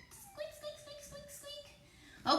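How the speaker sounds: tiny, very high, soft squeaks; mouse squeak. Pretend. Kids' yoga class playing mice, squeaking very high. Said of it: "what does a mouse say?".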